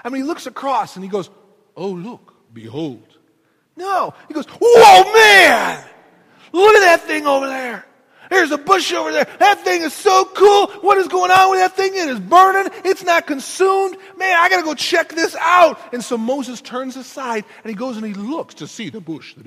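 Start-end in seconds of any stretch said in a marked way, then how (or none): 1.8-3.0 underplayed, low stilted wonder; hushed, unimpressed pretend awe; mock-solemn understatement. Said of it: "do you think that's exactly how it was read". 4.5-15.8 loud, high-pitched gushing excitement; hammed-up awestruck yelling; amazed Moses. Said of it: "what's really going on in his mind".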